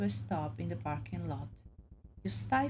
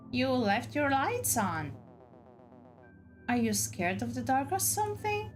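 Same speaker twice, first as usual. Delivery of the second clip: pixie; 5 semitones higher than usual. Acted. Moth creature teases child.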